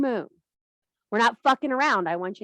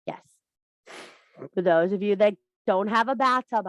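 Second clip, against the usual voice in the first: normal talking voice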